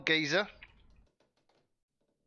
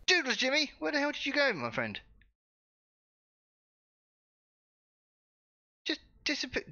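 puzzled calling out; 11 semitones higher than usual. Real, not acted. Calling after vanished NPC.

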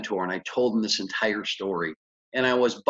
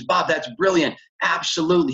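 higher-pitched imitation of an excited lender; 7 semitones higher, 3 dB louder than usual. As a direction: act out his thrilled reaction. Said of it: "tell how excited he was".

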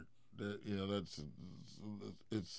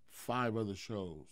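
ordinary delivery

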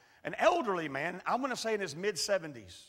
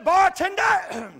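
calling out; 9 semitones higher, 11 dB louder, much slower than usual. Retold or imitated. Old man calls out to bartender.

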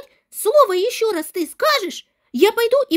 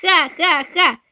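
spelt-out mocking ha-ha-ha, a bit lower; a smug bully's fake laugh; gloating laugh; 3 semitones lower, 3 dB louder, slower than usual. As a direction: laugh in her face, schoolyard style.

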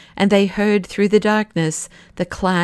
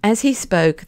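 plain reading voice

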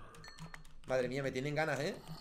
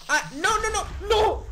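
much higher, loud shriek of panic; panicked scream; 12 dB louder, slower than usual. Real, not acted. Gamer screaming as a creeper explodes next to him.

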